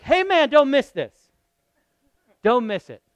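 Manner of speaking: imitating his wife nudging him; mock calling out. Wife calls him back on track.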